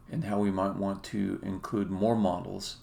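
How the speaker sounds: ordinary delivery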